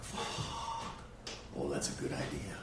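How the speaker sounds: low, scheming inner voice; sly realization. Retold or imitated. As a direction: drop into a hushed plotting tone. Realizing he can fake ADHD.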